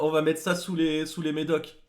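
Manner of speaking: ordinary talk, nothing marked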